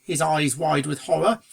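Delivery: ordinary delivery, nothing marked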